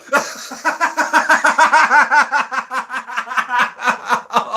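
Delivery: long high belly-laugh fit; helpless, prolonged real laughter; laughing to tears. Real, not acted. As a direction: laugh until you nearly cry. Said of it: "I'm gonna start crying here".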